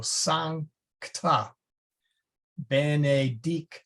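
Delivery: "sancta, benedicta" slowly, pausing inside each consonant cluster; split consonants. Shown as a demonstration. Teacher showing multiple-consonant split, slow spaced syllables. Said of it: "it's split typically after the first consonant".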